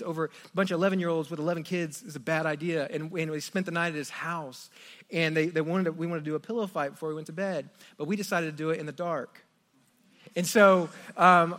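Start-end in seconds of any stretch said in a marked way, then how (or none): none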